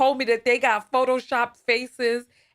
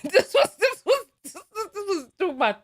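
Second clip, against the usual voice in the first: breaking into laughter, pitch way up; can't get the words out for laughing; 8 semitones higher than usual. Real, not acted. Radio host losing it over the caller's story, laughing.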